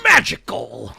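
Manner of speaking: slow booming cartoon villain read, far above his own voice; hammed-up slow shout like a bad cartoon line read; Juggernaut cartoon voice. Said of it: "That's exactly how he says it".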